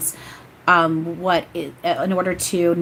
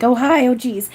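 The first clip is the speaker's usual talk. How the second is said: startled greeting; 6 semitones higher, 6 dB louder than usual. Retold or imitated. Greeting Yeshua as he arrives.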